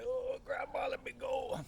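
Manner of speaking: put-on high, strained child's plea; squished little boy. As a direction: squeal it small, as if squashed in a hug. Squished child pleads with grandmother.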